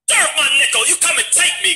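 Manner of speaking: hammed-up prison swagger; mock tough guy. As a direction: puff up like a con who fears nothing. Mock tough jail-hardened swagger.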